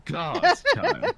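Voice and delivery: put-on shrieking high old-lady demand; shrill it up at the end; creepy countess. GM voices the countess.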